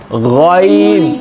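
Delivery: गॉइन drawn out slowly with rounded lips; Arabic ghain demo. Shown as a demonstration. Teacher demonstrates the letter for students, slow. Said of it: "इसको again नहीं पढ़ना".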